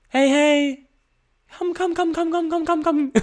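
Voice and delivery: very high, rapid-fire coaxing call; high luring call. Shown as a demonstration. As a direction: lure them like summoning a pet. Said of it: "if someone went like this".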